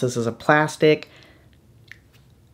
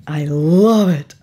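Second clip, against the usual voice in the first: normal talking voice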